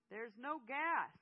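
alarmed, drawn-out yell; yelled announcement. A narrator's reading. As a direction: call it out from a car window. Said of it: "stuck his head out of the car and yelled".

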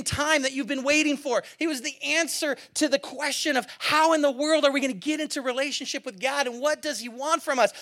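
impassioned preaching. Acted. Pastor retelling Peter before the crowd, impassioned preaching. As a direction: preach it like the crowd is in front of you.

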